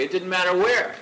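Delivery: ordinary delivery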